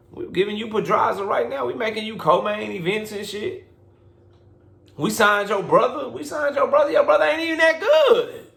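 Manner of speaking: rising indignant promoter's rant, half-mocking; voicing Top Rank scolding. Promoter scolding an ungrateful fighter, mock indignant.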